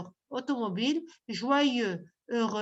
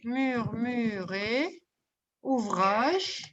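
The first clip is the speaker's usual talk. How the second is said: "Murmurer" stretched out very slowly; slow dictation; 4 semitones lower, much slower than usual. Real, not acted. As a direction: dictate "Murmurer" sound by sound, lower in pitch. Teacher dictates a word to pupils. (dictée très lente, en détachant les syllabes)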